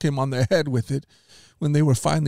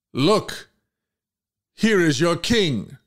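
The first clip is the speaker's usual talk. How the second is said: higher, slow proclamation; slow and lifted, showing him off; a lightly voiced governor; Pilate presenting; 5 semitones higher, slower than usual.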